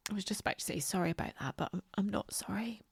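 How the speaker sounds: softly, after crying; quiet, tearful whisper. Real, not acted. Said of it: "In fact, I'm going to".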